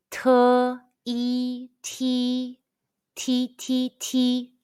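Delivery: "Te, e, ti" high and level, then "ti" repeated shorter; high-pitched first tone. Shown as a demonstration. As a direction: drill "ti" on one high note for repetition. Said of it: "the high-pitched first tone all together".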